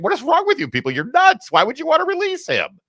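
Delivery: mock exasperation at the reporters; incredulous Bukele. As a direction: treat them as nuts. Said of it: "here's the president of El Salvador saying".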